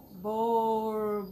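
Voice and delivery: "Borboleta" slow, in four drawn-out pieces; syllable by syllable. Shown as a demonstration. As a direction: stretch out each syllable of "Borboleta". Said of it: "quatro pedacinhos".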